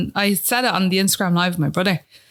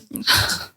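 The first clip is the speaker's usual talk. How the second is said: tearful sigh. Real, not acted. Struggling with tears.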